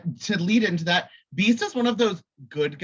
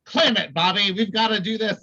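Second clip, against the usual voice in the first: serious Cyclops; 4 semitones higher, 5 dB louder than usual. Retold or imitated. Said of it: "'cause Cyclops is so serious".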